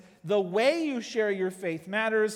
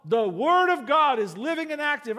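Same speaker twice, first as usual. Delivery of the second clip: loud, raised preaching tone; louder, higher preachy declaration; 5 semitones higher, 6 dB louder than usual. Shown as a demonstration. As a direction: proclaim it at them like a pulpit. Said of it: "you're already preaching at them".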